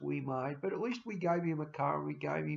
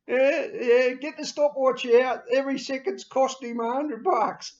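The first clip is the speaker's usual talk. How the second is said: much higher and louder, calling out gleefully; greedy adviser on the phone; 10 semitones higher, 12 dB louder than usual. Retold or imitated. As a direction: shout down the phone to rush the bill. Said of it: "I'll go pick up the phone and go".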